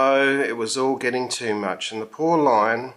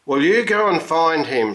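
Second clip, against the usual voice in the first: higher, louder commanding shout; shouted order; 5 semitones higher, 5 dB louder than usual. A narrator's reading. Manager shouting orders at the hungover tamer. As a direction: order him off to find it. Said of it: "shouted the manager".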